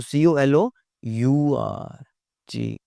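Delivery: slow, letter by letter spelling. Shown as a demonstration. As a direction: spell each letter slowly and clearly. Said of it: "spelling". (धीरे-धीरे अक्षर बोलना)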